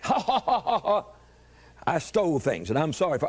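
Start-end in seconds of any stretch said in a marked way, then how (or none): 0.0-1.0 mock laughter. Mimics himself laughing at own story.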